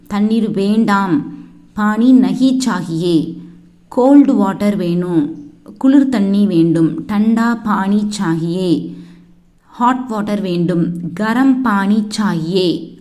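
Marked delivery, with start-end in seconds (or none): none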